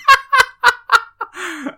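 loud, high-pitched laughing fit; burst of laughter. Real, not acted. Co-host teased over troll headphones, high helpless laughter. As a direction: crack up at being teased.

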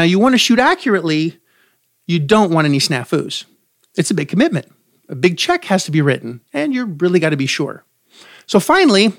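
usual speaking voice

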